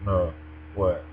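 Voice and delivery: usual speaking voice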